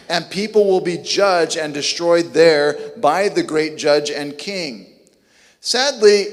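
normal talking voice

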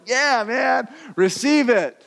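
high, eager and beaming; delighted giver. Retold or imitated. Giver urging a stunned friend to take it, high and delighted. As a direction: press the gift on them happily. Giver urges gift on someone.

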